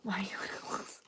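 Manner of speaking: voiceless whispered question; hushed, barely audible whisper. Pretend. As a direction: whisper it very softly.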